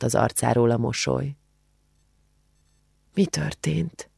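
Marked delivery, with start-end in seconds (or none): 3.1-4.1 hushed, choked-back question, much softer; stifled voice. A narrator's reading. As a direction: ask it under your breath, voice held back. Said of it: "kérdezte folytott hangon".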